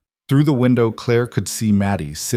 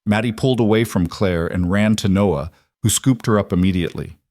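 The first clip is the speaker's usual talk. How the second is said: ordinary delivery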